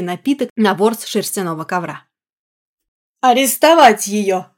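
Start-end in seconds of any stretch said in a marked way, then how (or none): 3.2-4.5 slow, louder command; barked order. Acted. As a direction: order the arrest sharply. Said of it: "отдал приказ Байрон-младший".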